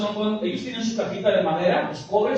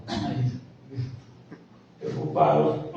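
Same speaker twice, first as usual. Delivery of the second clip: lazy sighing husband; 8 semitones lower, 5 dB quieter, much slower than usual. Retold or imitated. Husband's sighing reply voiced.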